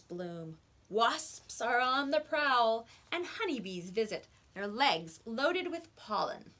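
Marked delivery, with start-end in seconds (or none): none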